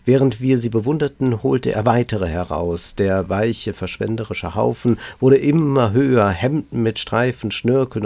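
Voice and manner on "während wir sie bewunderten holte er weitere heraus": ordinary delivery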